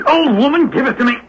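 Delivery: very high falsetto struggle; falsetto fight. Acted. Disguised man fighting over diamonds, high falsetto. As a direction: shriek it in a strained falsetto.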